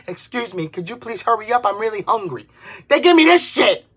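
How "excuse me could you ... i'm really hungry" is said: softer, overly sweet request; imitating a polite customer asking someone to hurry up. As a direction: ask nicely, holding the anger back. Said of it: "when I say".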